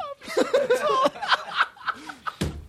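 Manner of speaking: squealing laugh way up high; high shrieking laugh. Real, not acted.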